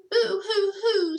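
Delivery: high, slow loud sobs; high slow wailing boo-hoo; sobbing. A narrator's reading. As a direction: bawl like a scared puppy. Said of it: "sobbed the dogs".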